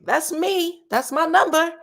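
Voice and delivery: high, slow gleeful voice; excited lottery winner. Retold or imitated. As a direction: act out spotting your winning numbers. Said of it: "and you're like".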